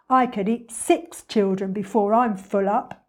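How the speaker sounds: a hint of ravenous crocodile; hungry crocodile. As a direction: boast greedily as the crocodile.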